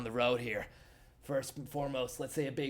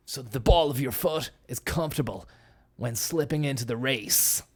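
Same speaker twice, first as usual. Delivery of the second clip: hammed-up race-day ad read, pushed louder on the product pitch; mock sponsor read; 2 semitones higher, 5 dB louder than usual. Host plugs absurd sponsor shoes, hammed-up ad read. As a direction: sell the shoes like a motorsport advert.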